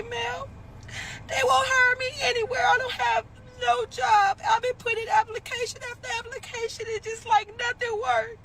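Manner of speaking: put-on desperate voice; fake pitiful plea. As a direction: beg like you're starving. Acted pitiful plea on phone.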